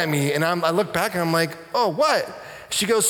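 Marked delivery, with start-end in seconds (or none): 1.7-2.3 higher-pitched startled comeback; mild retold surprise; taken aback. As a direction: react as if caught off guard. Acted retort in quarrel retold.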